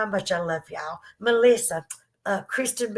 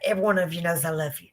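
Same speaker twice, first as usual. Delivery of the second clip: ordinary talk, nothing marked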